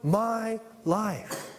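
slow, separated emphasis on "my life"; stress on MY. Shown as a demonstration. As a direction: hit "my", pause, then "life". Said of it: "the emphasis is on".

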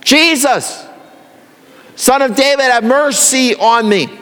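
high, pleading yell for mercy. Retold or imitated. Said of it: "he even just yelled louder".

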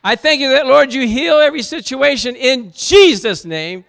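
loud, high praying shout; fervent prayer. Acted. Preacher showing how he prays, loud and fervent. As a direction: cry it out to the Lord in faith. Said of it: "I say".

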